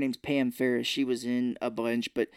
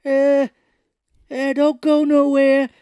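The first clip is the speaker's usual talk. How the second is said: much higher, loud and slow bellowing voice; loud gruff tough guy; 12 semitones higher, 11 dB louder, slower than usual. Retold or imitated. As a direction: bellow it across the restaurant like a goon. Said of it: "this is how he talks".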